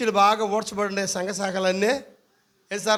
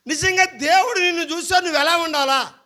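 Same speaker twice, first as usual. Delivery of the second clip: raised, louder challenging question; preaching challenge; 7 semitones higher, 5 dB louder than usual. Acted. Pastor challenges the congregation, raised and insistent. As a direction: press the crowd with a raised rhetorical question.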